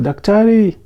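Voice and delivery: loud high call across the compound; a father calling his son across the yard; shouted name. Retold or imitated. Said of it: "uh, shouted".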